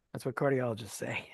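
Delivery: soft unvoiced whisper, half joking; whispered aside. Real, not acted. To his co-host about cardiologists, a whispered wry aside.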